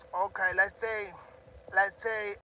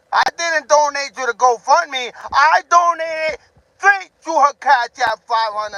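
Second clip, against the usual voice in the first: indignant shout; 6 semitones higher, 10 dB louder than usual. Real, not acted. Indignant loud insistence.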